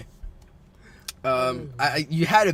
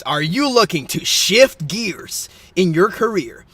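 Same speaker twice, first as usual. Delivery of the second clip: louder, upbeat newscaster delivery; mock news read; 4 semitones higher, 7 dB louder than usual. Host reads silly job news to co-host, mock newscaster. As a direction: hype it like a news anchor racing a timer.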